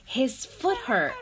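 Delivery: ordinary delivery, nothing marked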